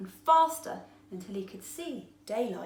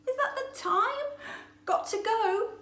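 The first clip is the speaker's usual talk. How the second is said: much higher, louder, with a laugh; third fish; 13 semitones higher, 5 dB louder than usual. A narrator's reading. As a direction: laugh it off and dash away. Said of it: "said another fish".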